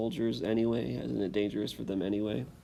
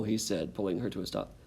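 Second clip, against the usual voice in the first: lively read-aloud, louder with rising tension; story narration; 2 semitones higher, 4 dB louder than usual. A narrator's reading. Teacher reading the exciting last page to students, dramatic storytelling. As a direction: build the chase for the listening kids.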